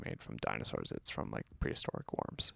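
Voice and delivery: whispered aside. Real, not acted. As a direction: drop to a whisper for the punchline.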